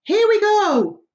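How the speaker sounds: high, loud joyful cry; excited shout. Acted. Creature shouting as the room lurches again, excited. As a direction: holler with glee.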